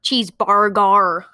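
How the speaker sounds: exaggerated fake American accent, hard R's in "cheeseburger"; British attempt at American accent. Child showing a Brit trying cheeseburger, overdone American accent. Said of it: "British accent tries to say cheeseburger in an American accent".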